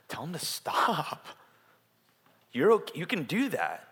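animated imitation of reassuring a friend; emphatic reassurance. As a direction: urge him as if granting permission. Retold urging to a suffering man.

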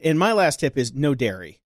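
ordinary talk, nothing marked

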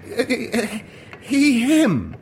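high, stammering answer under threat; nervous stutter. Acted. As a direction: stumble over the words in fear. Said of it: "he stuttered".

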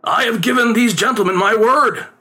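lightly voiced pleading father; father pleads. A narrator's reading. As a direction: beg the boy to cooperate. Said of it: "his father pleaded".

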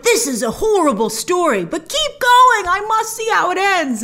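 playful reaction, can't stop watching; mock intrigued viewer. Reviewer acting out her hooked reaction, mock intrigued.

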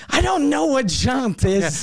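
high, slow, put-on clueless voice; playing dumb. Telling the mechanic he doesn't know the word, high and slow. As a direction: draw it out like you've never heard the word. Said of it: "And I was like".

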